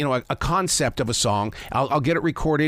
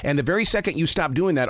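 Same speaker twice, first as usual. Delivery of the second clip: ordinary talk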